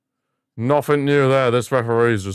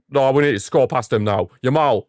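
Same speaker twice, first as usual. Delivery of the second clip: normal talking voice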